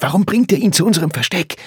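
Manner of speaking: fast, high, agitated hiss. A narrator's reading. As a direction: hurl it out as an urgent reproach. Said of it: "zischte er".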